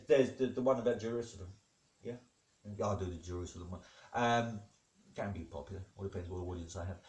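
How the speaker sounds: ordinary delivery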